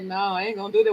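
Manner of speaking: low, soft refusal; talking to herself. Retold or imitated. Woman refusing her own order, low hushed self-talk. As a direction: quietly answer yourself back. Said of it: "I had to talk to myself".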